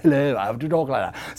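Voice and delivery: mock the talk of the very rich; affected rich-man voice. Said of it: "Somehow when you become rich, you talk like that".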